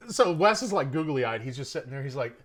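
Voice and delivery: ordinary talk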